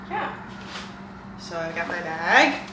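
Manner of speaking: ordinary delivery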